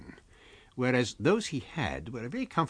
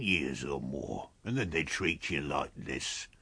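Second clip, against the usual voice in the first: low, very slow character voice; deep, ponderous vagrant complaint; tramp Mr. Marvel, grumbling; 6 semitones lower, 3 dB quieter, slower than usual. A narrator's reading.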